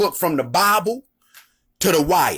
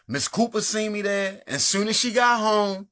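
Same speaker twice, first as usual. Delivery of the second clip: normal talking voice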